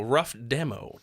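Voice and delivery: ordinary talk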